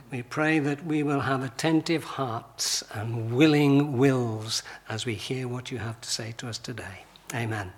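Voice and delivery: low, soft prayer voice. Real, not acted. Preacher praying for the congregation before the sermon, low and soft. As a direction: pray quietly, letting sentence ends sink.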